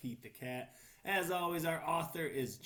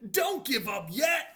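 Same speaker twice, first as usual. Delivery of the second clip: higher, louder cheering shout; Pete shouting encouragement; 9 semitones higher, 13 dB louder than usual. Acted. Pete the Cat shouts to the spider, cheering shout. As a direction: shout it out to keep him going. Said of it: "Pete said".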